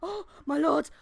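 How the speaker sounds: loud, high, breathless warning; panicked young lad. Acted. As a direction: shout the news like a frightened boy. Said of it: "young lad runs up and he's just like".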